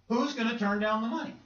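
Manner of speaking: high, disbelieving question rising at the end; incredulous question. Acted. Acted doubter asks about refusing money, incredulous. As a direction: ask it as if nobody would refuse.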